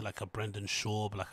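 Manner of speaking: normal talking voice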